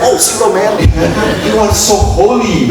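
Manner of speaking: lightly hammed-up awe; mock pious remark. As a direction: tease him as overly holy. Mocking the kneeling youth.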